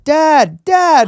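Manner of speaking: put-on high, loud little-girl voice; high-pitched child's call, acted out. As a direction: imitate a kid calling out for a parent. Imitating little daughters calling father.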